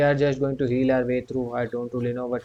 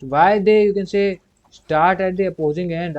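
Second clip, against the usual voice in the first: higher, louder, baffled complaint; frustrated outburst; 6 semitones higher, 7 dB louder than usual. Real, not acted. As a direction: complain loudly at the game. Enemies spawn at far end.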